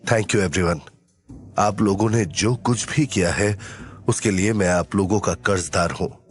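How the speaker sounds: low, composed hero's voice; grateful hero. Acted. Hero thanking his companions who found him, grateful. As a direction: speak low and sincere.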